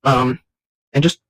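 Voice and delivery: normal talking voice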